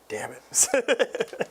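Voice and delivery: high laughing damn it; laughing curse. Real, not acted. Realizes he left unit alone.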